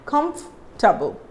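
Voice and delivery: "Comfortable" as KUMF, tuh-bul; drawn F then a small break in "Comfortable"; stressed F. Shown as a demonstration. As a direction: lean into the F of "Comfortable", O silent. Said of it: "I'm stressing on F here".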